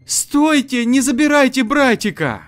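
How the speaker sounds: high desperate yell after a car; shouting. Acted. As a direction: scream it, very high and loud. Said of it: "закричав".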